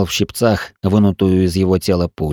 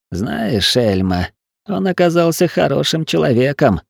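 sighing; 7 semitones higher than usual. Acted. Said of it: "вздохнул Тиль".

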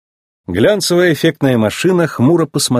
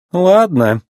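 unimpressed, higher-pitched brush-off; indifferent reply; 8 semitones higher than usual. A narrator's reading. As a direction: answer with no interest. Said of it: "безразлично отозвалась она, ничуть не впечатлившись".

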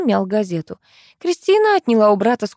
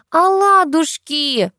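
put-on high, drawn-out little boy's cry; treble; 10 semitones higher, 3 dB louder, much slower than usual. A narrator's reading. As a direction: pipe it up in a child's treble. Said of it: "дискантом вёл Никитос". (тянуть детским дискантом)

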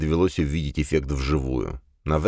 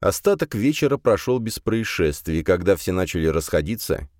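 plain reading voice

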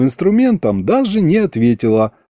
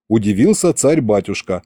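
ordinary delivery, nothing marked

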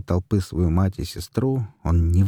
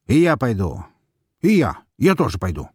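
eager volunteering; 4 semitones higher, 4 dB louder than usual. A narrator's reading.

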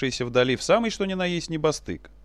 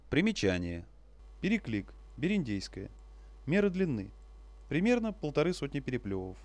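footnote aside; 9 dB quieter than usual. A narrator's reading. Footnote read aside, softer.

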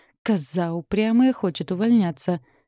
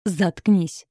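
angry snap. A narrator's reading. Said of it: "Дан окончательно вышел из себя".

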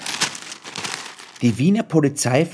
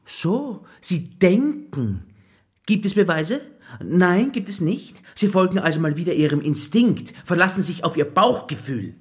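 high, sneering sarcasm; mock his gut feeling, superior and condescending; sarcastic mocking; 7 semitones higher than usual. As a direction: boss sneering at the detective's hunch, sarcastic. Boss sarcastically mocks detective.

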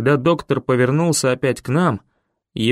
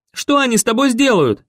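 much higher, louder panicked question; frantic cry; 10 semitones higher, 3 dB louder than usual. A narrator's reading. Boy struggling in a grip, panicked cry to Ben. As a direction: scream it in fear for him.

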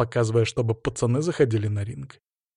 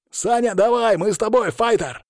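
fans shouting; 9 semitones higher than usual. Acted. Fans calling out from front rows.

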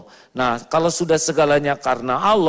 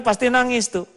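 normal talking voice

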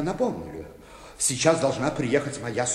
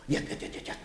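whispered to himself; 10 dB quieter, faster than usual. Acted. Steadying his nerves.

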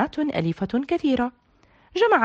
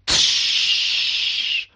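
whispered tsh splash sound; splash noise. A narrator's reading. Narrator imitating Tarek falling into the pool, voiceless splash. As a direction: make the sound of a body hitting water.

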